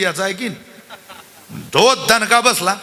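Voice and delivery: normal talking voice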